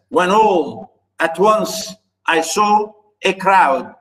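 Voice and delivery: slow "when ALL, at ONCE, I SAW, a CROWD"; deliberate metrical beat through "When all at once I saw a crowd"; iambic scansion. Shown as a demonstration. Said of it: "Unstressed stress, unstressed stress".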